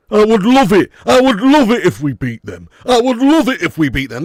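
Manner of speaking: loud, impassioned Yorkshire-style rant; Kevin Keegan impression. Streamer testing where Keegan is from, full-voiced mock Yorkshire outburst. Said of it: "That's gotta be Yorkshire".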